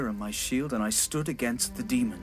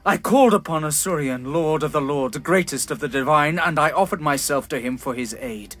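rising anger; 3 semitones higher, 6 dB louder than usual. Acted. King rails at the gods.

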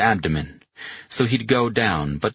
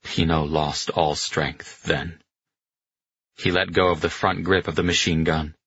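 ordinary delivery, nothing marked

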